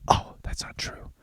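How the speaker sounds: hushed mock whisper; soft whispered surprise; whispered realization. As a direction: breathe it like a discovered secret. Heroine's realization in retold plot.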